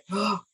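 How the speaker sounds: quick audible intake of breath; acted gasp of shock; retold shocked gasp. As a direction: gasp as if stunned by the news. Acting out her shocked reaction.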